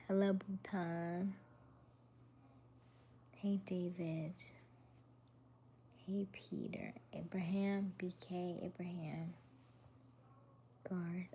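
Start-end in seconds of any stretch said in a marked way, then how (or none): none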